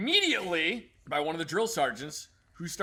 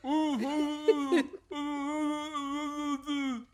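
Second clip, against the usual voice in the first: slow, high muffled oohs through a stuffed mouth; muffled full-mouth hooting; mouth full of crackers; 10 semitones higher, much slower than usual. Retold or imitated. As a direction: mumble the creed with four crackers in your mouth. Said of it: "it came out as".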